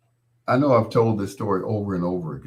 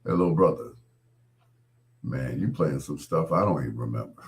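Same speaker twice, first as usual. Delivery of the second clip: lightly voiced deep-voiced singer; lower, easygoing impression of a deep-voiced man; 6 semitones lower, 3 dB quieter, faster than usual. Singer leaning over to praise the DJ, lightly imitated deep voice. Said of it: "in that deep voice of his".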